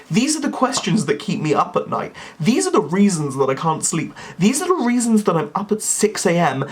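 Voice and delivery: hammed-up despair, pitch swooping up; mock-tormented rant. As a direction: build to a melodramatic lament. Mock tormented rant about Windsor.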